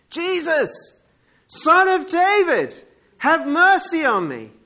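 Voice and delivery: high, loud, drawn-out shout; full-voiced, high-pitched plea; crying out. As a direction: call out for help over a crowd. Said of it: "he's just crying out".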